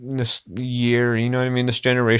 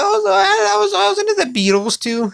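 high silly voice. Pretend. Sudden high voice on Beatles.

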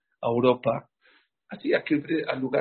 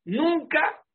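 "Nunca" much higher and louder; emphatic never; 14 semitones higher, 3 dB louder than usual. Acted. Preacher warning the congregation, loud high "Nunca". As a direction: hit "Nunca" hard.

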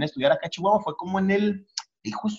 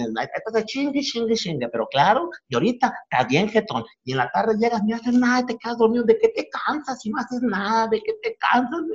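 higher-pitched mock nagging; exasperated mom; 7 semitones higher than usual. Comedian imitating his mom nagging about sleeping all afternoon. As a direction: scold a lazy son with disbelief. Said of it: "cosas ... con las mamás".

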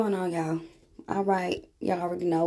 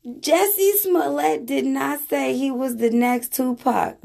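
indignant correction; 8 semitones higher, slower than usual. Real, not acted. Protesting the clip's claim.

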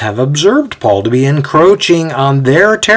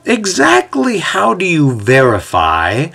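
voice from the crowd; 5 semitones higher than usual. A narrator's reading. A listener in the crowd questioning Paul, calling out. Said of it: "Calling out from the crowd, a voice says".

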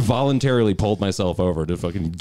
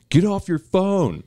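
much higher, slow barked order; angry cop; 13 semitones higher, slower than usual. Retold or imitated. Retelling the cop's order to the driver, mock angry. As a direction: bark it like a furious cop.

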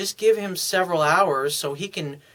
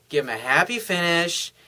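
ordinary talk

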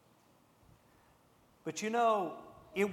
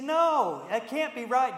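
higher, louder shocked protest; disbelief; 8 semitones higher, 9 dB louder than usual. Acted. Jonathan protesting to David, louder disbelieving denial. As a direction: push back hard, he can't believe it.

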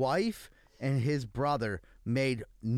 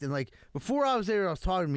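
ordinary delivery

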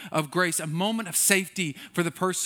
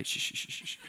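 unvoiced shh, much softer; breathy hushing to calm a crier; mock shushing whisper; 10 dB quieter than usual. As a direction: whisper the shushes like calming a child. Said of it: "You probably do it nicer than that".

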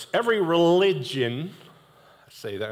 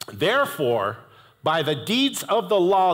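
raised, slow scripture read; 5 semitones higher, slower than usual. Acted. Preacher reading scripture aloud to the congregation, raised and slow.

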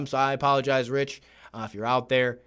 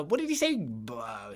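normal talking voice

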